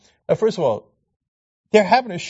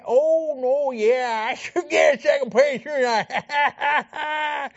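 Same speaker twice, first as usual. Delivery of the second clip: mock preacher; 8 semitones higher, much slower than usual. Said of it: "so God, what you say".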